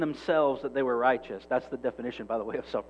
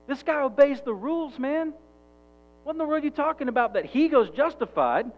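higher and higher, outraged disbelief; indignant disbelief; 9 semitones higher, 6 dB louder than usual. Retold or imitated. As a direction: build to an incredulous protest. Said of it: "self-righteous person going".

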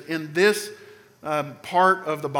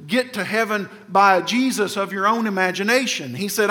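ordinary talk

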